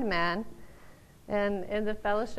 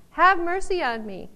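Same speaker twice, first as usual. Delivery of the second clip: loud and higher, earnest plea; crying out; 7 semitones higher, 6 dB louder than usual. Pretend. Preacher shows how to cry out to God, loud. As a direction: cry it out with full need. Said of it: "we are really crying out".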